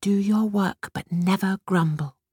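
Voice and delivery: put-on girl's meek echo of the rule; obedient repeat. A narrator's reading. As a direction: repeat it dutifully. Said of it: "repeated Batushka obediently".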